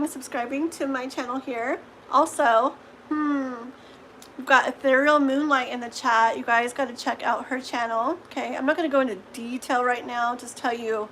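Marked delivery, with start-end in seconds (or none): none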